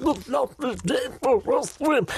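put-on high, frail old lady calling out; the aunt voice while swimming; aunt's voice. Doing the aunt voice to his family in the sea. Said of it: "I was doing".

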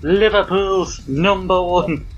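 put-on slow, drawn-out Liverpool Scouse accent; a hammy put-on Scouse accent. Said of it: "Your Scouse accent's horrific".

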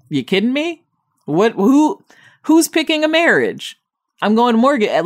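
can't believe anyone picks that; incredulous. Real, not acted. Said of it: "are you kidding me?".

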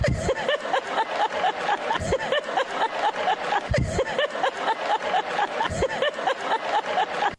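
long high laugh into the mic. Real, not acted. Host laughs at his story.

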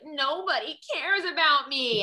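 put-on high, loud, slow whiny toddler wail; drawn-out bratty tantrum cry; mock temper tantrum. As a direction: whine it like a two-year-old throwing a fit. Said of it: "have, like, a temper tantrum".

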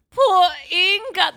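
high, loud, slow mock pity; mock sympathy. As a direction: overdo the sorrow. Mock mourning.

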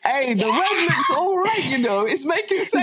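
squeaky, giggling high voice; high laughing voice. Pretend. High laughing mock Italian voice.

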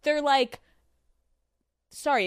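usual speaking voice

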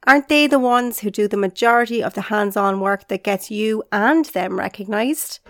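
ordinary talk

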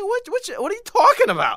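high, incredulous what are you talking about; baffled retort. Retold or imitated. Answering angry tweets in high disbelief. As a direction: sound floored by the accusation. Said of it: "I'm like".

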